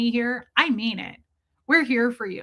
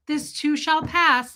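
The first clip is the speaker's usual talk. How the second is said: drawn-out, higher-pitched mock comfort; mocking platitude; 5 semitones higher, slower than usual. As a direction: stretch out an empty platitude, pitched up.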